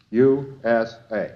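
ordinary talk

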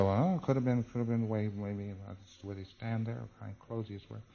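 very soft, halting guesswork, trailing off; hushed, unsure musing; tentative recalling. Acted. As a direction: act out searching memory, murmured to yourself. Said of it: "he said".

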